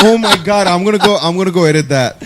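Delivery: high laughing excitement. Real, not acted. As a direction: laugh through the words. Laughing at tagging joke.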